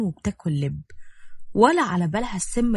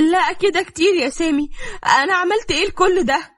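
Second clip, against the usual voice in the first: much higher and louder, crying out; desperate outcry; 11 semitones higher, 5 dB louder than usual. A narrator's reading. Wife crying out at her husband, desperate and high. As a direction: at the end of her rope. Wife breaks under the abuse.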